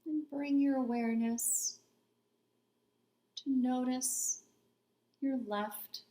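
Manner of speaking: unhurried meditation voice with long pauses between phrases; calm, spacious meditation guidance; guided body scan meditation. Real, not acted. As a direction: guide them gently into stillness, leaving space after each phrase.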